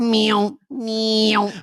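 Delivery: high whining saw by mouth; bandsaw sound effect. Acted. Showing Sound Effecty at the bandsaw, whining saw noise. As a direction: let a long high saw whine drop away.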